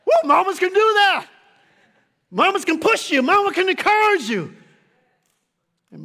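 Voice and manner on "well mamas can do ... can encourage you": loud, high preacher's build; full-force preaching. Acted. Preacher rousing the congregation about mamas, loud preaching.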